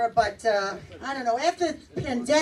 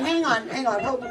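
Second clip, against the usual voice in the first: normal talking voice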